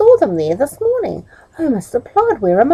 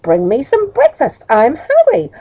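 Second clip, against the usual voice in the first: cross; 6 semitones higher than usual. Acted. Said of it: "said Middle crossly".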